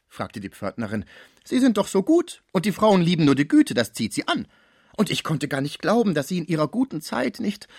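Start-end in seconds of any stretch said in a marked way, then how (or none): 1.4-7.7 much higher, loud, mocking disbelief; incredulous concierge. A narrator's reading. Concierge mocking the sick man's chastity, high and loud. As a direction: scoff loudly at his claim. Cibot coaxing.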